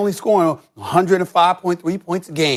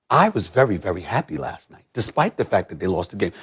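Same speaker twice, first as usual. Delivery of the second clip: much lower and softer, choked-up hush; mock emotional; 11 semitones lower, 6 dB quieter than usual. A fan hamming up tears over his team's loss, hushed and low. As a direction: pretend to be overcome. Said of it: "I'm getting emotional right now".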